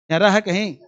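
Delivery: ordinary delivery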